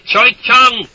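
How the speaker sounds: high, loud holler. A narrator's reading. Soldier hollering at villagers, high and loud. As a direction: throw it out across the village. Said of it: "he'd holler".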